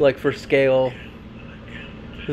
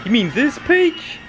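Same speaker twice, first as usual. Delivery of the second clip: mock character shout; 9 semitones higher than usual. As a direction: yell the line like the cartoon spider. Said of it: "shows his ass".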